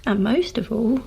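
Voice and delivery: ordinary talk, nothing marked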